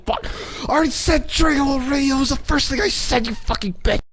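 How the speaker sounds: high, fast, screeching rant; hammed-up frantic rage; furious shriek. Real, not acted. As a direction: shriek it out in mock fury at the correction. Angry outburst over name correction.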